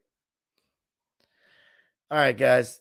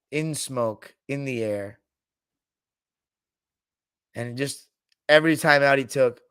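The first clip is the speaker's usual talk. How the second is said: ordinary delivery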